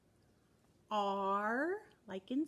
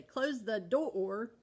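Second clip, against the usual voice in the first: silly drawn-out; 4 dB louder, much slower than usual. Shown as a demonstration. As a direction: Close the door said very slowly, every word stretched. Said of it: "that's not how you say it".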